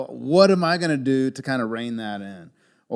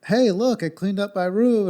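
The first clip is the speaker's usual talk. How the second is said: put-on higher, chirpy little boy's boast; proud son; 10 semitones higher, 4 dB louder than usual. Son shows dad his cleaned room, proudly and higher. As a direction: chirp it like a kid hiding the mess. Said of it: "he's like".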